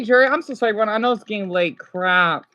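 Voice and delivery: ordinary delivery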